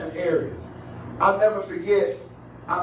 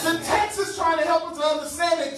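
high sermon cadence with stretched key words; emphatic preaching; 8 semitones higher than usual. Real, not acted. Preacher expounding the text to congregation, emphatic preaching. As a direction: preach the point, leaning on the big words.

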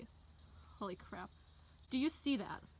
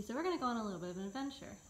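normal talking voice

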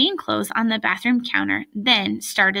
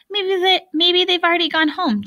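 high-pitched, hopeful suggestion; tentative, higher-voiced wishful guess; 9 semitones higher, 4 dB louder than usual. A narrator's reading. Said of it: "Jeff said hopefully".

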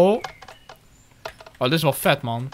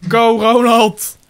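urgent shout; 5 semitones higher, 6 dB louder, much slower than usual. Real, not acted. Urging to run.

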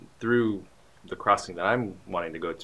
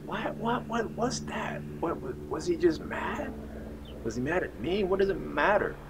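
high-pitched baffled questioning; imitating a bewildered inner monologue; puzzled thinking; 9 semitones higher than usual. Retold puzzled thoughts walking home.

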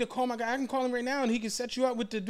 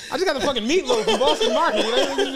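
louder, fast and cracking up; laughing; 4 semitones higher, 6 dB louder than usual. Real, not acted. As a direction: blurt it out mid-laugh. Laughing about the meatloaf.

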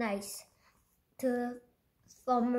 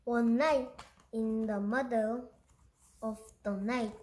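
usual speaking voice

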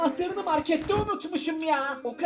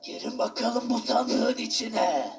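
menacing villain; 5 semitones lower than usual. Acted. Villain shopkeeper voice.

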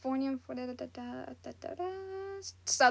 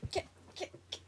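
whispered shoo; 16 dB quieter, faster than usual. Real, not acted. Shooing a bug away.

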